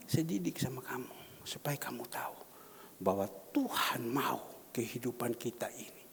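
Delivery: soft whisper of the repeat. Shown as a demonstration. (mengulang dengan berbisik)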